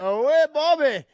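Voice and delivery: "Bobby" copied high and loud, in delight; delighted repeat. Real, not acted. Guest thrilled by the host's Irish "Bobby", shrieking it back. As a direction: squeal "oh, wait, Bobby" with glee. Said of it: "That just made my whole day".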